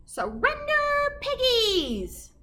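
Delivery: very high, slow and drawn-out; wicked witch writing a demand in the sky; witch command. A narrator's reading.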